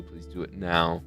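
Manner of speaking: ordinary delivery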